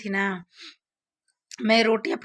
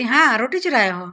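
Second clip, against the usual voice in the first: put-on accusing child's cry; 2 semitones higher, 6 dB louder than usual. Accusing brother of stealing roti.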